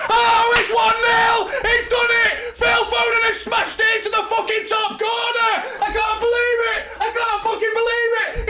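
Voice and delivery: screaming with joy at the top of his lungs, pitch soaring; ecstatic goal scream. Real, not acted. Fan screaming at his stream as City score. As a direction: erupt in disbelieving euphoria as the ball hits the net. Goal celebration on a live watchalong.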